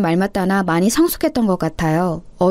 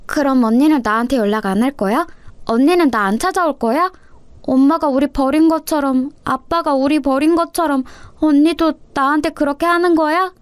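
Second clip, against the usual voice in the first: put-on higher, hurt little-girl voice; pleading little sister; 6 semitones higher than usual. Little sister asks her older sister if she will abandon her, pleading. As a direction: plead like a child fearing abandonment.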